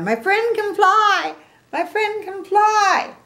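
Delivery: very high, loud, slow cheering; thrilled cheer. A narrator's reading. Gerald jumping up and down for his friend, high cheering. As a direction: cheer it while bouncing with joy. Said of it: "Gerald's jumping up and down".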